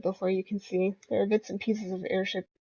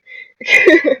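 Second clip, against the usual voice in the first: loud laugh; 7 semitones higher, 13 dB louder than usual. Real, not acted. Player laughs at the chant, loud high laugh.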